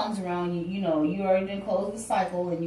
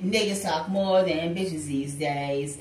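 lower, slow lyric recital; 6 semitones lower, slower than usual. Retold or imitated. Reader quoting [name] at the Gemini, slow lower lyric. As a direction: lay the line down slow. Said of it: "Ain't that [name]?".

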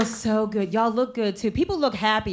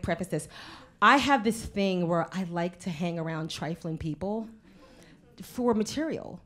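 ordinary talk

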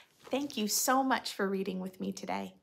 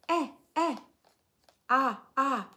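short higher eh and ah sounds, lightly done; mock vocal sounds; 3 semitones higher, 4 dB louder than usual. Shown as a demonstration. Reading to children, lightly imitating a child's sounds. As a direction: voice the children's simple sounds playfully. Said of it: "make sounds".

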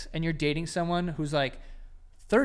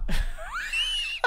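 shrieking laugh. Real, not acted. Bursts out laughing.

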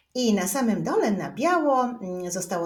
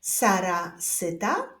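slow, word-by-word reading; unhurried, spaced-out read-along; reading together; slower than usual. Shown as a demonstration. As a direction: lead learners through it slowly, pausing after each sentence. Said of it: "przeczytajmy wspólnie wszystkie zdania".

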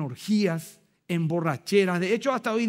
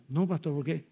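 hushed reply; 4 semitones lower, 5 dB quieter than usual. Retold or imitated. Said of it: "le digo al costado".

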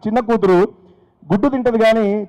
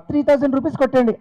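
madam voice; 7 semitones higher than usual. Retold or imitated. Minister's wife ordering petrol.

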